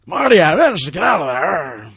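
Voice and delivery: loud, high, hammed-up movie impression; Doc Brown. Comparing the villain to Doc Brown for co-hosts, loud mock impression. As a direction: shout it like a frantic movie scientist. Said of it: "he sounds like Doc Brown".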